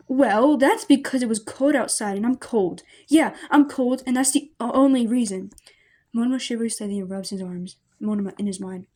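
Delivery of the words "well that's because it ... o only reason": nervous excuse. Acted. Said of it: "Monoma laughs nervously".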